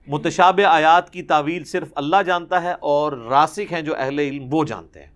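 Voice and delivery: ordinary talk, nothing marked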